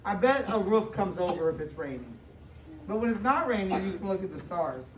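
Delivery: usual speaking voice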